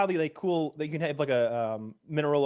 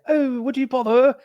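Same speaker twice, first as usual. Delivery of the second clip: very high, louder caricature of posh British; mock posh British accent; 12 semitones higher, 5 dB louder than usual. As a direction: exaggerate a well-spoken British toff. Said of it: "American people think we all sound".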